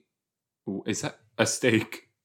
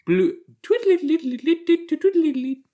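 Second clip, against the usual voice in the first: very high, piping flute tootles; flute impression; 4 dB louder than usual. Shown as a demonstration. Playing on Bobby Flute's name, piping flute noises. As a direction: trill like a little flute. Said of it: "That was my flute impression".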